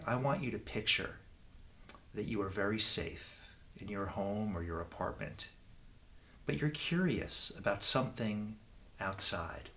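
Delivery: ordinary talk, nothing marked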